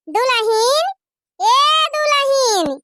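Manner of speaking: calling out. Acted. Third call for the daughter-in-law.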